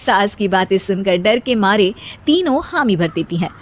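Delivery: ordinary delivery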